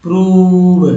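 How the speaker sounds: प्रूव with a loud, stretched ऊ; teacher sounding out प्रूव emphatically; long oo sound. Shown as a demonstration. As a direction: hold the oo in प्रूव. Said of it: "यहाँ पर उच्चारण ऊ हो रहा है".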